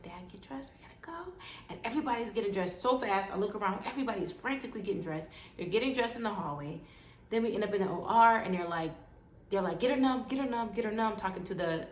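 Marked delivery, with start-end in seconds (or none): 0.0-1.3 very soft, hurried aside; hushed urgency. Retold or imitated. Doctor telling dad to get dressed, hushed and urgent. 9.8-11.1 higher, insistent repeated command; urgent orders. Retold or imitated. Operating room team to anesthesiologist, urgent.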